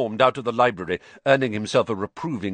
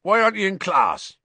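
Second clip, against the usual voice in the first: big, loud, higher-pitched question; hearty Hagrid; 11 semitones higher, 6 dB louder than usual. A narrator's reading. Hagrid finding Harry out of class, hearty. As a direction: boom it out in surprise.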